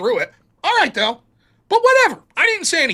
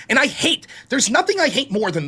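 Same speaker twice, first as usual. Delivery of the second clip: ordinary talk, nothing marked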